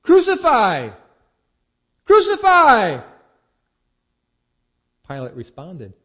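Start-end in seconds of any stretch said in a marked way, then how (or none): 0.0-0.9 loud, much higher cry of Crucify!; a high shouted Crucify!; crowd shouting. A narrator's reading. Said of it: "they shouted". 2.1-3.1 louder, higher, slow drawn-out Crucify!; the mob's peak Crucify!, slowed and very loud; crowd screaming. A narrator's reading. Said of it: "they shouted".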